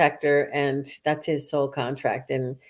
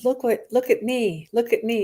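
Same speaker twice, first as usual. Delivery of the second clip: lightly voiced, higher young girl; eager little "look at me", pitched up; 5 semitones higher than usual. Medium voices a daughter to her mom, lightly girlish. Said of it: "a young girl would say".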